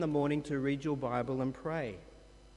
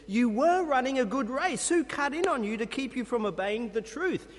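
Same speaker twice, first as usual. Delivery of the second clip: fuming Paul; 6 semitones higher than usual. Retold or imitated. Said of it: "is fuming".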